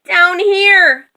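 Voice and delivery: high, carrying little call; high-pitched distant hail; calling from below. A narrator's reading. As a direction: call up from far down. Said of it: "Minnie called softly".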